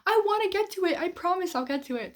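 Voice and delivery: high, eager, begging-to-be-believed promise; pleading promise. Retold or imitated. Vlogger answers commenters who keep asking, high eager promise. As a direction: promise it like a guilty kid swearing she will. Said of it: "somebody comments and I'm like".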